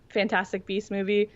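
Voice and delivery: usual speaking voice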